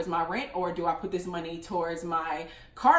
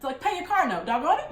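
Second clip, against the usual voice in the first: louder, high, pushy "doggone it"; mock scolding; 7 semitones higher, 3 dB louder than usual. Best friend ordering her to pay the car note, loud and high. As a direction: boss her into paying it.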